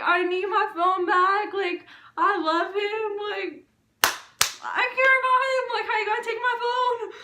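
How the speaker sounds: high-pitched, hammed-up crying protest; mock tearful whining. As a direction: whine it as if crying. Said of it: "I kept on tearing up all day".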